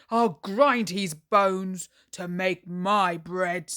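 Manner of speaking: loud, booming giant threat. Acted. Hungry giant sniffing for Jack, loud booming threat. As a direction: menace the whole house with it.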